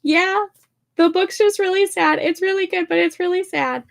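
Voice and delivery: high, choked-up reply to mom; tearful reassurance. Retold or imitated. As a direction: squeak out that it's just a sad book. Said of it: "I'm like".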